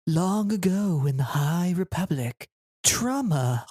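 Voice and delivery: slow, grand movie-trailer narration; epic fantasy narrator. Pretend. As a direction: intone it like an epic saga opening. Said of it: "That was really good".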